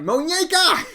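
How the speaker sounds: high, loud yell. Acted. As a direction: yell the name while running off. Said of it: "runs off yelling".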